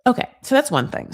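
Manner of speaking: usual speaking voice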